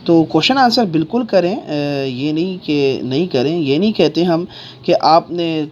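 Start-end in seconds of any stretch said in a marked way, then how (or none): none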